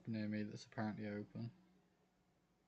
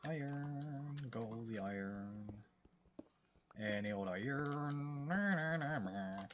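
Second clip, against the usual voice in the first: put-on drawn-out, singsong Southwestern accent; 3 semitones higher, slower than usual. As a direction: chant it slowly in a Southwestern accent. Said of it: "I've got a good, um, Southwestern accent".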